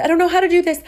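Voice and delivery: pleading in mounting panic; mock distress. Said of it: "And I said".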